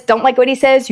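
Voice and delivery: ordinary delivery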